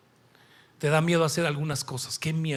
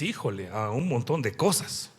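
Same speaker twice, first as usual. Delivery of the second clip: sheepish confession; 6 semitones lower than usual. Acted. Imagined listener admits many fears.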